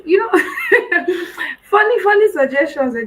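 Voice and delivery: loud laugh spilling into the words; amused, laughing aside. Real, not acted. Laughs at her own suggestions.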